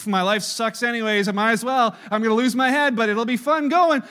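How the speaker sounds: loud, carefree mock temptation; hammed-up give-up-and-enjoy-it voice; mock reckless bravado. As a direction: sell the giving-in as a loud lark. Voicing Joseph's imagined temptation.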